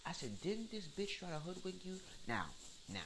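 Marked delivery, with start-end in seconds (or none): 0.4-2.0 high, retold disbelieving question; incredulous challenge. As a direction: question a friend's judgment, pitched way up. Retells his incredulous challenge to her.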